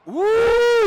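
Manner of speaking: loud high whoop; celebratory whoop. Real, not acted. Announcer cheering a sideline catch, loud high whoop. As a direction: let out a joyful whoop.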